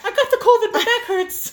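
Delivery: high, loud whining imitation; mock her complaints in a whine; imitating a whiny hypochondriac friend, pitched high. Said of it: "She's been a hypochondriac today".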